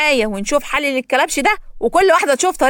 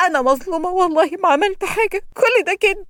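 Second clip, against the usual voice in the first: higher, protesting voice; pleading innocence; 7 semitones higher than usual. A narrator's reading. Prisoner protests innocence to the officer, rising pleading. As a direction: insist you've been wronged.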